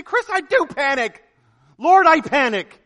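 high, loud, put-on whine of panic; hammed-up listener confessing panic; mock anguished congregant. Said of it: "You say".

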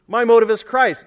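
emphatic repeated avowal. Acted. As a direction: proclaim it as Paul with conviction. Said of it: "Paul emphatically says".

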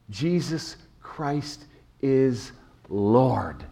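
very slow, every word weighted; slow proclamation. Acted. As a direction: lay down Jesus Christ is Lord word by word. Climactic sermon declaration.

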